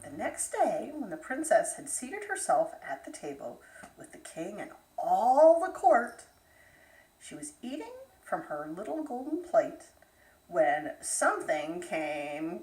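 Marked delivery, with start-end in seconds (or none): none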